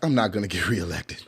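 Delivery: low, soft muttering mayor voice; mock mayor. Commentator voicing the mayor's defeat, low and soft. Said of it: "he's like".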